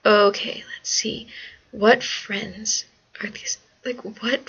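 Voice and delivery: whispered musing to herself; whispering. Real, not acted. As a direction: whisper it like thinking aloud. Musing to herself while deciding.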